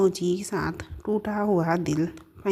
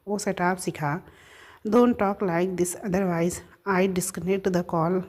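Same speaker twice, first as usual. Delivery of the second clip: ordinary delivery, nothing marked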